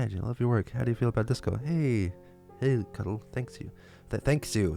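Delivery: ordinary talk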